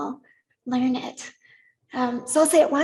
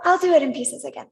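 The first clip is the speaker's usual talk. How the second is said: normal talking voice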